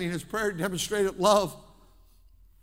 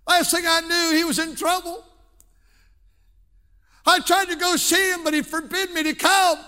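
impassioned preaching; 6 semitones higher, 6 dB louder than usual. Acted. Preacher retelling a parent's prison visits, impassioned and rising. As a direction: voice climbing and growing louder to a shout.

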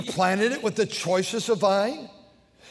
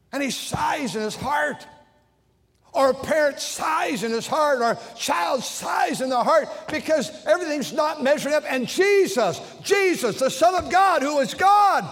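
preaching at full force; 7 semitones higher than usual. Acted. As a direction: drive it home like a revival preacher.

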